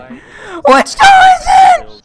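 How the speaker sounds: very high, loud and slow; panicked drawn-out shout. Real, not acted. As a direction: stretch it into a desperate shout. Panic in chase.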